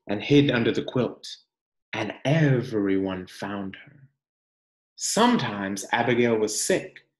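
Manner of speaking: gentle, expressive picture-book reading; reading to children. Acted. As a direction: read it like a storybook for the class.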